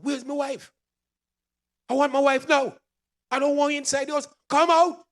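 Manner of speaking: high, loud imitated outburst; retold shouting. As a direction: yell it like a confused old man wanting his wife. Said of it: "Start to shout at me".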